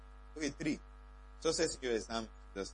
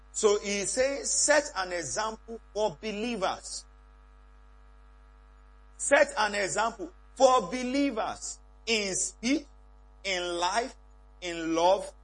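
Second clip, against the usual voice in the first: preaching; 5 semitones higher, 5 dB louder, slower than usual. Acted. Preaching the verse emphatically.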